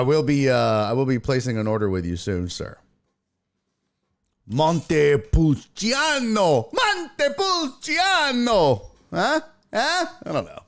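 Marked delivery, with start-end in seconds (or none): 4.5-6.7 Montepulciano drawn out slowly, Italian vowels; careful Italian wine name. Shown as a demonstration. Streamer showing off the wine name, slow and careful. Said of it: "I just say it really fast". 6.7-8.9 Montepulciano sung out high and slow with Italian vowels; high mock Italian. Shown as a demonstration. Streamer repeating the wine name, high and theatrical. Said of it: "I just say it really fast".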